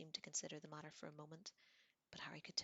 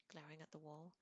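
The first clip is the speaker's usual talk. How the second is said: ordinary delivery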